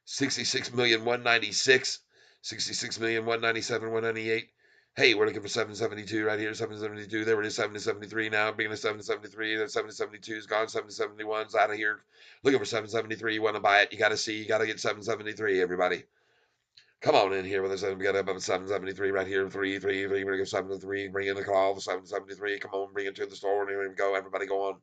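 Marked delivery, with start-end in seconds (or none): 1.0-16.0 rapid-fire mock auction chant; auctioneer fast talk. Streamer hyping the stock price for fun, auctioneer fast talk. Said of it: "fun action talk. Now we're saying talking really fast". 17.0-24.8 low, fast run-on patter; auctioneer fast talk. Pretend. Streamer calling everybody in to buy, auctioneer fast talk. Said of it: "talking really fast".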